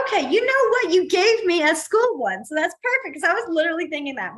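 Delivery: high, loud burst of delight; real excitement at a perfect suggestion; excited. Real, not acted. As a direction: exclaim it eagerly, pitched well up. Thrilled by a viewer's suggestion.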